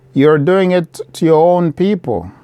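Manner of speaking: ordinary talk, nothing marked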